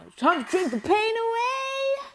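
high, slow, sung-out declaration; dramatic proclamation. Pretend. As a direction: proclaim it like a grand hero. Dramatic mock toast.

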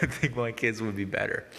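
repeating the line through leftover laughter; can't quite keep a straight face; laughing retake. Real, not acted. Apologising to viewers, repeats the joke still half laughing. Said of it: "I'm sorry".

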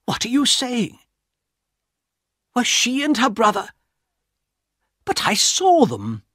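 a frightened passenger begging for help; urgent Mister Harris. Acted. Harris protests.

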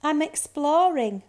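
mildly gloomy high cub voice. A narrator's reading. Bear cub answers the girl, gloomy. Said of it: "little Snow Bear said gloomily".

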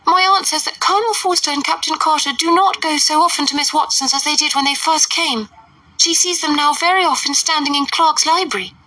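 put-on high, eager girl's call; high excited young girl; giddy Lydia. A narrator's reading. Lydia voiced by reader.

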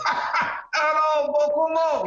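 high-pitched mock laughing Japanese; laughing old man. Old guy at the bar chiming in, laughing. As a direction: crow it out through a put-on laugh. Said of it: "the old guy beside me is laughing. He's going".

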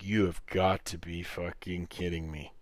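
quiet, understated exasperation; soft, deadpan retold reaction; retold disbelief. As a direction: say it under your voice, in weary disbelief. Disbelief at uncle's answer.

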